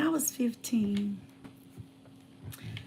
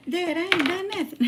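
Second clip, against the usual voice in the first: higher and louder, pleading innocence; insistent protest; 8 semitones higher, 3 dB louder than usual. Retold or imitated. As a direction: insist to dad you did nothing wrong. Teen insists innocence to dad.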